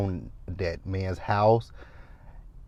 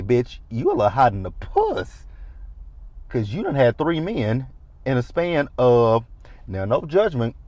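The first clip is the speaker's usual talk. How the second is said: ordinary talk, nothing marked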